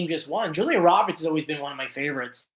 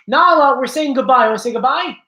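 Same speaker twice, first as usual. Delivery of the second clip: calling the dog; 5 semitones higher, 7 dB louder than usual. Real, not acted.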